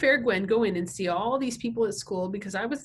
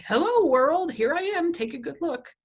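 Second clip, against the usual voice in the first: mock announcement; 9 semitones higher, 6 dB louder than usual. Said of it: "it's like".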